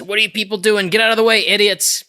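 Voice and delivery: mock-irritated and high; exasperated boss. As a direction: act out a boss scolding his underlings. Acting out Queen ordering Pleasures aside.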